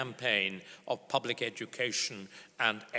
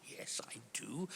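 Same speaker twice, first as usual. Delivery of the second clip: whispered reply; 14 dB quieter than usual. Retold or imitated. Whispered reply at Dresden dinner.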